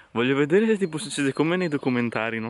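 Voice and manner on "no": ordinary talk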